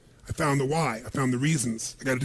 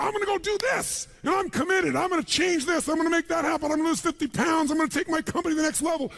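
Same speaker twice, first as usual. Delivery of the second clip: high-pitched, hyped-up mock resolve; mock excitement, voice pushed way up; all excited; 11 semitones higher than usual. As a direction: declare it like an overexcited go-getter. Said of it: "And you're all excited".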